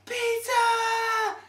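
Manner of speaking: very high, loud squeal of the word; imitated shriek. Limo girls spotting the delivery guy, high loud squeal. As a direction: scream pizza like excited party girls.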